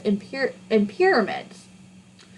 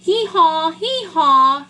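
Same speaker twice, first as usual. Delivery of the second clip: donkey's hee-haw; 4 semitones higher than usual. A narrator's reading. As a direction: bray like a donkey in distress. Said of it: "yelled the donkey".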